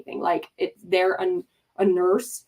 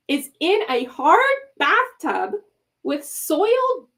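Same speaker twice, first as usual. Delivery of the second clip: high, outraged disbelief, rising on each phrase; exasperated rant, pitched well up; appalled; 8 semitones higher, 4 dB louder, slower than usual. Real, not acted. Appalled retelling of bathtub birth scene.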